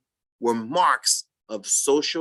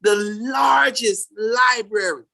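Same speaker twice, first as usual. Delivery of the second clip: proud loud declaration; 7 semitones higher, 3 dB louder, slower than usual. Real, not acted. Proud claim about history.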